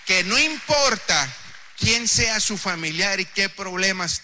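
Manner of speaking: loud, emphatic sermon voice; full-force preaching. Acted. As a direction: press the message home to the church. Preaching loudly to congregation.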